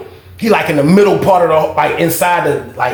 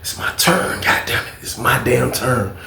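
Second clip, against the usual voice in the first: soft, hushed inner voice; calm, low-volume private resolve; calm self-talk; 5 semitones lower, 7 dB quieter, slower than usual. Retold or imitated. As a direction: murmur it slowly to yourself. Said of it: "I'm just being calm".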